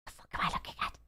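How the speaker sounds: very soft, voiceless mutter; baffled whisper. Real, not acted. Viewer puzzling over what is on screen, baffled whisper.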